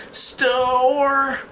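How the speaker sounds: high drawn-out imitation of struggling speech; mute girl's strained attempt. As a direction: force out one long, high word, mocking a mute child. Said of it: "but she's mute".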